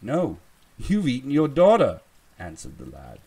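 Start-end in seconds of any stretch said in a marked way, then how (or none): 0.0-2.0 high, loud retort; triumphant taunt. A narrator's reading. Lad answering the troll, loud and taunting. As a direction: throw it out loudly in triumph.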